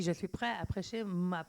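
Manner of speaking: ordinary talk, nothing marked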